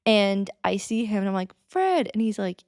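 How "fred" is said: higher, calling his name across the alley. Retold or imitated. Fan calling out to the musician in the alleyway, high and eager. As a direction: call out to catch him as he leaves.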